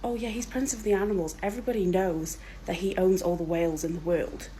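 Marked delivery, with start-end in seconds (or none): none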